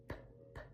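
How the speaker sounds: airy P puffs, no voice; unvoiced P. Shown as a demonstration. Teacher isolating the unvoiced P. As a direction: pop P as air only. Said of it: "just air, no voice".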